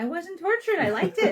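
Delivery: high, loud, amused objection; laughing protest. Real, not acted. Host teased about smoked fish childhood, laughing protest. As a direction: push back loudly through a laugh.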